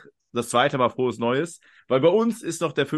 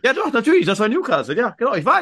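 sudden realization; 7 semitones higher, 5 dB louder than usual. Real, not acted. Suddenly remembers being in Newcastle.

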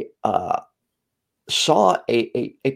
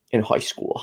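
Boston accent; 4 dB quieter than usual. Shown as a demonstration. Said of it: "I think it's like a Boston accent".

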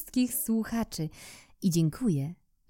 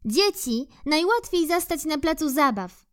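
squirrel remarking; 9 semitones higher than usual. Acted. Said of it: "zauważyła".

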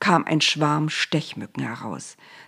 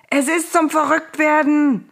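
high, loud exasperated wail; howling with rage; 10 semitones higher, 5 dB louder than usual. A narrator's reading. Pharaoh driven mad by croaking frogs, howling with rage. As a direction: howl it out, stretching the end. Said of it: "Der Pharao heulte vor Wut".

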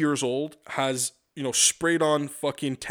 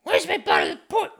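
much higher, louder squeaky demand with a rising end; shrill mock waitress; 5 dB louder than usual. Rude waitress demanding the port, in a squeaky mocking voice. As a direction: redo her nagging even more shrill and bratty.

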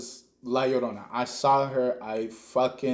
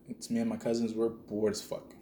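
ordinary talk, nothing marked

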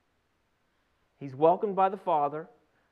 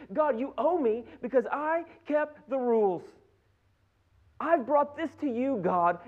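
higher, louder acted outcry to God; indignant pleading; 4 semitones higher, 4 dB louder than usual. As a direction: build an aggrieved case, hammering each point. Acted believer complaining to God.

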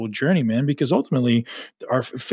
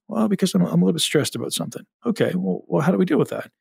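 put-on childlike reply; 6 semitones higher, faster than usual. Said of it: "Why do you get anxiety".

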